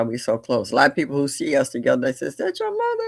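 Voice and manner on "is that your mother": high-pitched astonished asking; high, incredulous retold question; onlookers' surprised question.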